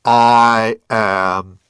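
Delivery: loud, majestic divine proclamation; voice cracking like lightning. A narrator's reading. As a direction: boom it out like thunder. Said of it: "a voice that cracks like lightning".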